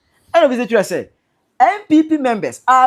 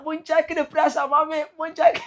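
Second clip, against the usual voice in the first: higher, sing-song mock of a man checking his pressure; mocking Kevin Taylor; 5 semitones higher, 4 dB quieter than usual. Commentator mimics Kevin Taylor checking his pressure to mock him, high and whiny. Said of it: "Kevin Taylor".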